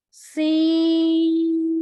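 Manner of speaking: सीन held five counts with hiss; सीन held long; long seen. Shown as a demonstration. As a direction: stretch सीन slowly, whistling s. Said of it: "सीटी की आवाज आएगी".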